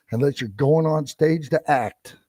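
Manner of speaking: ordinary talk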